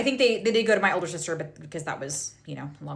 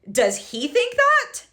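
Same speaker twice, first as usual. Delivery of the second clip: pitching up in outraged disbelief; incredulous; 11 semitones higher than usual. Retold or imitated. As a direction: voice shooting up in shocked disbelief. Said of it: "And I was like".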